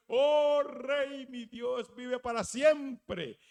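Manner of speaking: loud, proclaiming hail to the king; loud respectful hail. Acted. Daniel hailing the king from the pit, loud and reverent.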